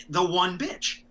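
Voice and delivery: ordinary talk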